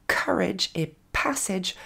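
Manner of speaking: COURAGE and PASSAGE slowly as "KUH-redge", "PASS-edge"; English plosive starts. Shown as a demonstration. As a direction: puff of air on the K of COURAGE and P of PASSAGE. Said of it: "commencez avec des plosives".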